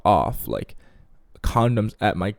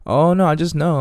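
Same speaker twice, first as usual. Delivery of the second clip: higher-pitched mock excuse; put-on girl voice, pitched up; imitating the girl; 6 semitones higher, 4 dB louder than usual. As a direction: play the girl brushing off a question. Voicing the girl's excuse.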